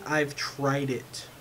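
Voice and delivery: usual speaking voice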